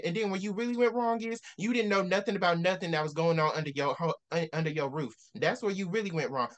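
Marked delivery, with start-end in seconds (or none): none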